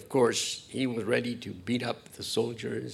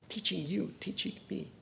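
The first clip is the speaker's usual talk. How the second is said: hushed; 6 semitones higher, 10 dB quieter than usual. Acted. Sermon hush.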